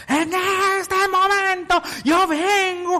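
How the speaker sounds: high, loud shouted demand. Acted. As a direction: bark the request at the neighbor. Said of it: "le digo". (exigencia a gritos, aguda y fuerte)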